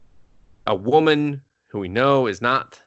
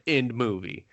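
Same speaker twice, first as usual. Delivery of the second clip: usual speaking voice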